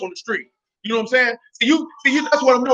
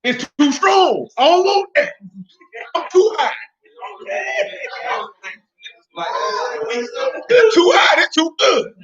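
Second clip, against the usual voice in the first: high-pitched mock smoker complaint; overwhelmed smokers; 9 semitones higher, slower than usual. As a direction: imitate smokers protesting, voice pitched way up. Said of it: "And they like".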